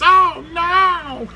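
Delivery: high, stretched cartoon robot wail; hammed-up high-pitched lament. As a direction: imitate a squealed catchphrase of alarm. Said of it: "There's a robot that says".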